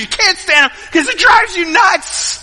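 rising to a high, loud holler; mock fed-up rant, stretched at the end; exasperated outburst. Preacher voicing fights with people in your life, exasperated yell.

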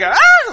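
short, loud mock scream; imitated shrieking yelp, very high; high-pitched scream. Dad screams as kayak sticks on rock, high-pitched mock shriek. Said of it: "kinda real high-pitched scream".

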